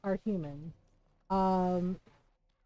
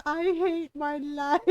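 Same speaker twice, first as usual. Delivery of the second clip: slow, higher, hammed-up wail; mock crying; 5 semitones higher, 4 dB louder, slower than usual. Streamer imitating poor Heron when he cries, mock crying. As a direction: draw it out as a pretend sob. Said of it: "like, cries. He's like".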